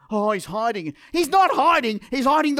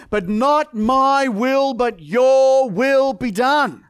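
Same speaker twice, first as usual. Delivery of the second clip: drawn-out, louder surrender; slow loud declaration; 3 semitones higher, 6 dB louder, much slower than usual. Retold or imitated. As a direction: proclaim it slowly with full force. Jesus' surrender, climactic.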